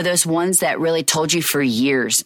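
ordinary talk

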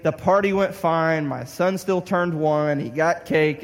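ordinary talk, nothing marked

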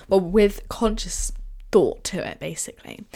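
usual speaking voice

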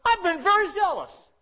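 lightly voiced prophet making his case; Elijah's complaint. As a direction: Elijah defending himself to God, lightly acted. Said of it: "He said".

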